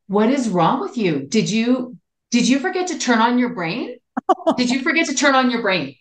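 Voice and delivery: incredulous mock-angry rebuke; retold mad scolding. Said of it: "I was mad".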